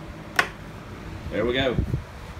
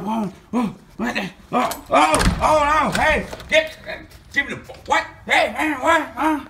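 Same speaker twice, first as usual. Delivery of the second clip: high, loud startled cries; panicked high yelp; 6 dB louder, slower than usual. Acted. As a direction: panic as someone bursts in. Panicked character being confronted.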